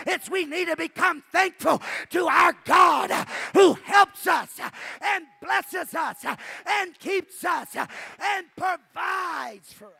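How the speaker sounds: high, loud, driving shouted sermon; full-force preaching. Real, not acted. Preacher urging the congregation to be thankful, full-force shout. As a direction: preach it shouted, drawing out each phrase.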